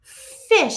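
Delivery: "Fish." high and loud, with a long hissing f; modelling the word. Shown as a demonstration. Teacher modelling "Fish." for young students, high and loud. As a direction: model "Fish." for the class, leaning on the f. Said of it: "I'm gonna talk to them".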